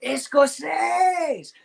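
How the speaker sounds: long, drawn-out high shout of Escocese; imitated Brazilian shout. Brazilian locals cheering at kilted Scots, a drawn-out high shout. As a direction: call it out like a cheering fan across the street. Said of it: "and they go".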